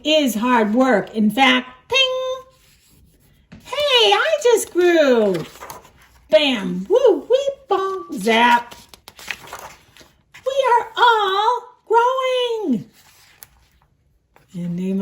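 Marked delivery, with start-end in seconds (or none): 1.9-12.9 high, slow and thrilled pig; high overjoyed Piggy. Acted. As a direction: sing it out like an overjoyed piglet. Piggy voice, sound effects.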